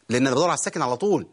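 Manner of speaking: ordinary talk, nothing marked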